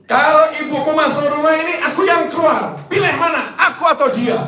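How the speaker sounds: high, loud mock wife shouting her ultimatum; shrill demanding wife. Preacher mocks a wife forcing her husband to choose, shrill shouting. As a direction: screech it like a soap-opera wife.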